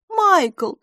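very high voice, falling tone; confirming. A narrator's reading. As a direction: confirm the name simply, pitched high. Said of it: "подтвердила мисс Лафос". (утвердительно, высоким голосом)

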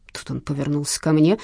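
ordinary delivery, nothing marked

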